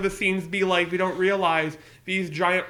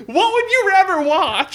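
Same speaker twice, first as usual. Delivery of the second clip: high, slow, louder teasing question; high mocking voice; 12 semitones higher, 6 dB louder, slower than usual. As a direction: tease with a high squeaky voice.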